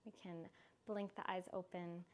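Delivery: soft calm closing voice; guided relaxation. Acted. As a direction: wind the class down gently. Soft closing of yoga class.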